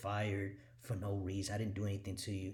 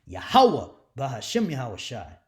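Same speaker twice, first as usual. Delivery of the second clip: Yahweh called out much louder; proclaiming shout; 11 dB louder than usual. Acted. Preacher declaring his God to his enemies, loud proclamation. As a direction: ring the holy name out.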